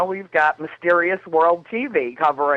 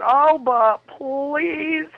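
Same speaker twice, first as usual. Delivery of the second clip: high, very slow, begging plea; a mock plea, pitched way up; drawn-out pleading; 10 semitones higher, much slower than usual. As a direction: stretch it out like you're begging sweetly. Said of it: "they called me and said".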